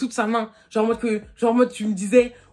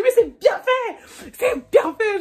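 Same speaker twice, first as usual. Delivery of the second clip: much higher and louder, drawn out slowly; triumphant gloat at the cheating boyfriend; 10 semitones higher, 6 dB louder, much slower than usual. Retold or imitated. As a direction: rub it in, savouring every word.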